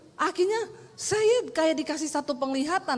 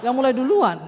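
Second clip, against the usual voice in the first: loud, indignant outburst; a loud mock-indignant snap at the husband; inner angry retort; 7 dB louder than usual. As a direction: fire back at the husband in your head. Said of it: "dalam hati saya".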